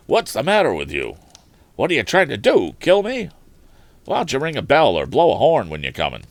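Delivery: loud, indignant yell of a knocked-down tramp, rising high on "kill me"; angry tramp. Acted. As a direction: shout at the rider who hit you. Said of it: "faced Tom angrily".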